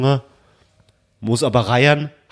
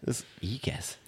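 whispered aside; 4 semitones lower, 16 dB quieter, much slower than usual. Real, not acted. Co-host gives up and whispers "ist Igas" to himself.

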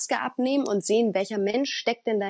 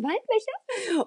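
put-on much higher, slow girly voice; drawn out and teasingly pitched way up; mock feminine voice; 13 semitones higher, much slower than usual. Frauen wollen weiblich klingen. (spöttisch hohe, gedehnte Frauenstimme)

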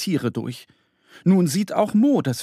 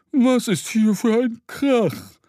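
put-on much higher, slow scolding old owl; grumpy scolding owl; 9 semitones higher, 4 dB louder, slower than usual. Woken owl scolding the noisy pair, grumpy. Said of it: "schimpft sie".

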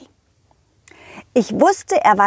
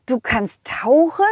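plain reading voice

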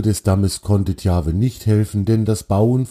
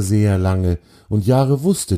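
plain reading voice

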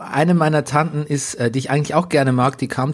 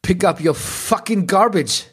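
slower, higher, sneering English; mock snarky youth; 5 semitones higher, slower than usual. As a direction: sneer it like a Gen-Z cyclist. Imagined snarky young woman. (spöttische junge Radfahrerin, langsam und höher)

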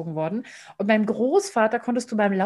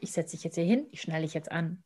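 calm firmness; 4 semitones lower, 8 dB quieter than usual. Shown as a demonstration. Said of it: "ich brauche diesen Termin".

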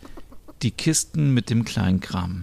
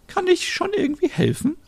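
put-on very high, thin old-lady voice; old neighbour offering help, high and frail; elderly Merit. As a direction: play a sweet old neighbour.

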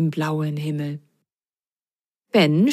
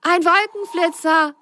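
much higher, thrilled exclamation; thrilled cry; 11 semitones higher than usual. A narrator's reading. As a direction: cry it out in delight. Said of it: "rief Ben begeistert".

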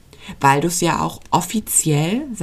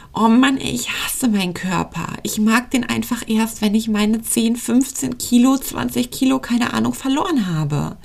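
frustrated inner voice; 5 semitones higher than usual. Acted. Host acting out a listener's self-hating diet thoughts, exasperated and higher. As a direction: whine it like someone fed up with their own body. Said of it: "Könnten es Gedanken sein wie".

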